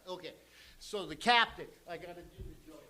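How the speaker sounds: usual speaking voice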